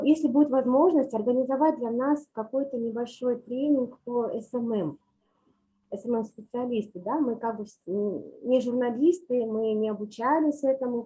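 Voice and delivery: normal talking voice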